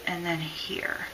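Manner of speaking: soft breathy aside, almost silent; whisper. Real, not acted. Chalk artist murmuring to herself at the board, whispered. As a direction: whisper it to yourself while drawing. Teacher marking tiny letter details.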